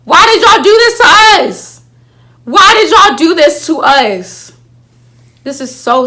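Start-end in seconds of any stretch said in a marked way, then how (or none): 0.0-1.7 much higher and louder, a wailing protest; anguished outcry. Real, not acted. Fan crying out to the writers, high loud wail. 2.4-4.5 louder and slow, stretching every word; a dragged-out despairing plea; drawn-out outcry. Real, not acted. Fan pleading with the writers, slow loud lament.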